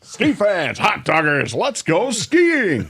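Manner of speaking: high, loud hyped-up promo, stretched slow; monster truck voice. Retold or imitated. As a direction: yell it like a monster truck rally ad. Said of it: "I did it in a monster truck voice".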